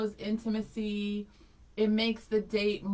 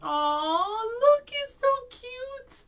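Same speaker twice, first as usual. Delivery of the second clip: gushing coo; 13 semitones higher, much slower than usual. Real, not acted. Cooing over a picture.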